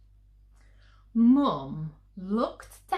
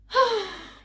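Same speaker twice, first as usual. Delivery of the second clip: a long, high, loud sigh; weary sigh; 14 semitones higher, 8 dB louder than usual. Acted. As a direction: let out a mother's tired sigh. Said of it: "Mum sighed one of her usual sighs".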